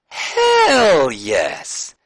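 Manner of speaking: pumped-up shout of victory; excited cheer. Real, not acted. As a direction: celebrate it. Hype cheer for perfect rating.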